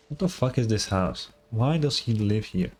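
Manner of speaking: ordinary delivery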